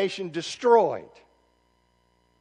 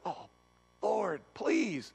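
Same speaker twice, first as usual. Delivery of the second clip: pleading Amos; 2 semitones higher, 8 dB quieter, much slower than usual. Acted. Amos begging God in retelling.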